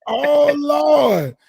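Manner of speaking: high, drawn-out wail of mock despair; playful dismay. Real, not acted. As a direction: groan it out long and high, in playful exasperation. Mock dismay at fans' team picks.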